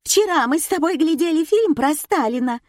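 high, shrill tirade; angered wife. A narrator's reading. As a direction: rail at the husband in a high voice. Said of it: "Запоздало разозлилась супруга". (разозлённая жена, на повышенных тонах)

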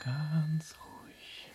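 very slow, drawn-out whisper; hushed, stretched-out whisper to oneself; whispered self-soothing. Acted. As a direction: whisper it slowly to calm yourself. Tom calms himself alone.

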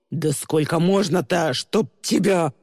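exasperated, angry outburst; flaring up. A narrator's reading. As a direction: lose your temper at the driver. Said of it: "вспыхнул Тристин". (вспылив, с раздражением)

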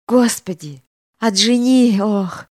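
groaning, clutching his heart; having a heart attack. Acted. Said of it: "невольно простонал, хватаясь за сердце".

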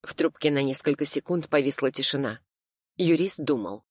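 ordinary delivery

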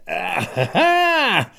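zvolání. Acted.

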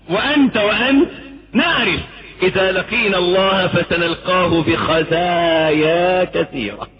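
usual speaking voice

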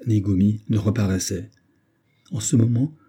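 ordinary delivery, nothing marked

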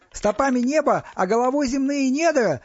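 loud, high-pitched shout; crying out. A narrator's reading. Стас jumps in to help his brother, shouting. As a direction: shout out the rest of the hymn. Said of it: "выкрикнул Стас".

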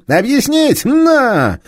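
angry detective shouting down a thief; enraged police inspector. Acted. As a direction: roar at the thief with fury.

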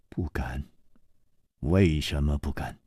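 put-on calm older hero. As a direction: speak low and unhurried, gently reproaching. Chu Liuxiang questions.

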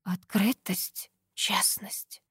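slow unvoiced rustling whisper; whispering wind. A narrator's reading. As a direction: breathe the words like wind. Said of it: "прошелестел ветер".

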